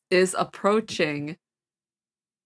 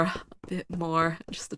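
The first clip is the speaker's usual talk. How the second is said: whispering; 5 dB quieter than usual. Real, not acted. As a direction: breathe it out, barely voiced. Sneaking past in game.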